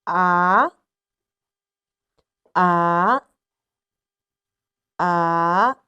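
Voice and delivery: "A" starting low and rising at the end; three "A"s each sliding upward; rising tone, repeated. Shown as a demonstration. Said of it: "raise the pitch a little bit".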